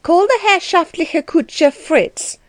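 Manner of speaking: stern command. A narrator's reading. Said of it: "in a loud and stern voice".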